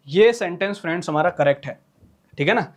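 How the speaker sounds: usual speaking voice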